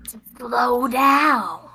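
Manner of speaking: slow, drawn-out whisper; sinister ear whisper. Acted. Game master as invisible foe casting slow in the ear, whispering. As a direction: stretch it out in an eerie whisper. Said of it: "whispers in your ear invisibly".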